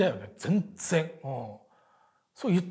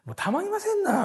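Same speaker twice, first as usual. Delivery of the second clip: much higher, put-on Osaka-dialect gripe; high Osaka-dialect lament; mock Osaka grumble; 13 semitones higher than usual. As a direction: whine it in Osaka dialect, pitched way up. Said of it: "みたいな大阪弁でずーっと文句言ってたわ".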